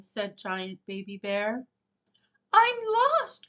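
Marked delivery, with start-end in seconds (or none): 2.5-3.5 high, loud little creature's cry; much higher, small plaintive voice; distressed cry. A narrator's reading. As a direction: cry out as a tiny lost one. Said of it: "cried the very small".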